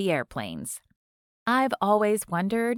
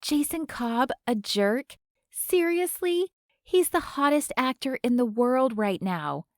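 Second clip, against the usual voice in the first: higher, incredulous starstruck fan; indignant fan; 4 semitones higher than usual. A narrator's reading. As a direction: scoff in disbelief, pitched up. Starstruck fan defending actor.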